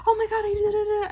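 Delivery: very high, giddy squeal of excitement; distracted by something shiny. Vlogger acts out spotting something shiny mid-talk, giddily distracted. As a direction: break off with a sudden high-pitched thrill. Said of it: "if I see something shiny".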